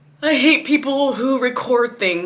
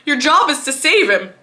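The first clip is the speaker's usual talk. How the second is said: loud, furious accusation; angry rant at the vet; 4 semitones higher, 7 dB louder than usual. Retold or imitated. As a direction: yell it at the vet who failed. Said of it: "I'm blaming them".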